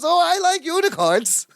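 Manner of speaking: very high-pitched character imitation; Balki. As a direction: voice the delighted sitcom character way up high. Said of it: "Bucky goes".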